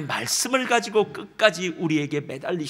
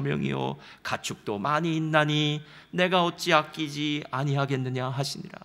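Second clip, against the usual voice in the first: scripture reading; 4 semitones lower than usual. Acted. Preacher reading the verse to the congregation, solemn. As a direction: read God's words out measured and grave.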